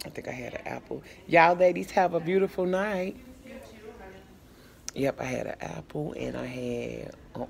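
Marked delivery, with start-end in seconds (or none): 1.3-3.2 loud, high call across the room. Real, not acted. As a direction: call out a cheery goodbye. Calling goodbye across the room.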